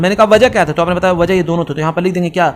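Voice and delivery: ordinary talk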